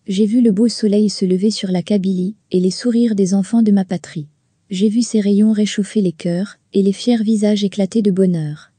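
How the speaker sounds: measured, earnest reading of verse; lightly declaimed poem; poem recitation. Acted. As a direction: recite each line like a heartfelt hymn.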